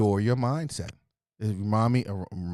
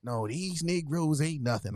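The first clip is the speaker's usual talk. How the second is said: higher, slow, scornful mock quote; mock scornful woman; dismissive women; 6 semitones higher, slower than usual. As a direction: imitate women writing men off. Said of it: "a lot of women who say".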